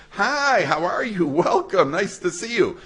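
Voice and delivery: high, bright gushing welcome at the door; warm welcome. Retold or imitated. Host at the door gushing over Shabbos guests, eager welcome. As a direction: greet the guests like you're thrilled. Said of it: "Sometimes people answer".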